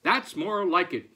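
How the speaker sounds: a touch of gruff-farmer satisfaction, punched up; satisfied farmer. A narrator's reading. Farmer approving the rooster's crow, loud satisfaction.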